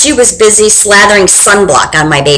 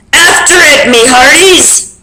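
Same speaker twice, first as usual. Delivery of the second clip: higher, ordering call; captain commanding the crew, higher; pirate command; 5 semitones higher than usual. Acted. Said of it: "Braidbeard commanded".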